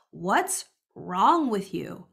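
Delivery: slow, drawn-out accusing question. Shown as a demonstration. To someone acting out, slow and blaming. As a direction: drag out "What's wrong with you?" like a reproach. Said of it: "you can change that question to".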